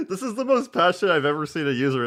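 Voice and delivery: high, loud, laughing through the words; laughing, incredulous exclamation; amused disbelief. Real, not acted. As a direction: talk while cracking up. Amused reaction to rant video.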